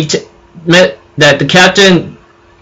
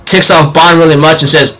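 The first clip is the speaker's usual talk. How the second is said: ordinary delivery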